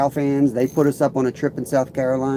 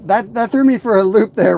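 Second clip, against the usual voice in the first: surprised; 9 semitones higher, 6 dB louder than usual. Real, not acted. Surprised by viewer comment.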